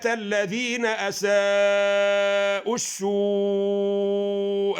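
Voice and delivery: slow melodic tajweed chant, long held vowels; slow tajweed recitation of the verse; Quran recitation. Shown as a demonstration. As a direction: recite the verse slowly, chanting each word out. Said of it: "تقول إيه؟".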